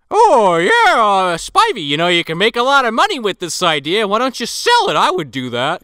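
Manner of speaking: hammed-up chipper news reporter. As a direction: play a square, overeager TV reporter.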